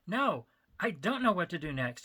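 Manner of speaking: barked, voice cracking; cracking bark under stress. Acted. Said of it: "he barked, his voice cracking".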